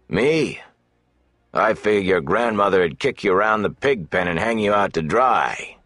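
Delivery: mock the boy and his sword; taunting thug. Big man taunts Rand about his sword, jeering.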